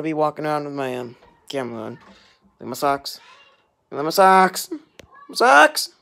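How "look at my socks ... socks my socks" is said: playful show-off. Pretend. Vlogger showing the camera their socks, playfully excited.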